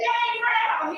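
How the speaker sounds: lady's call. Acted. Lady in joke calls him.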